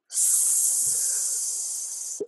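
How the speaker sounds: long soft unvoiced hiss; quiet snake hiss. Shown as a demonstration. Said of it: "hiss at me like you're a snake".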